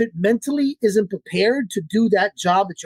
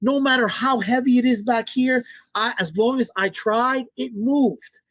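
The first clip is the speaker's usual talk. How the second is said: lightly voiced cocky dog, pitched higher; a dog bragging to itself, a touch higher and proud; dog's inner voice; 5 semitones higher than usual. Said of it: "So in their mind".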